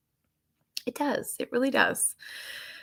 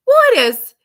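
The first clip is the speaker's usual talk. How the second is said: high, loud imitation of a child insisting; high-pitched mock child protest; little daughter's voice; 8 semitones higher, 7 dB louder, faster than usual. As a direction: mimic a three-year-old arguing back. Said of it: "And she's like".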